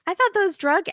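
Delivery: high, loud imitation of her outburst; mock her flying off the handle; set-off outburst. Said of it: "sets her off, and she's like".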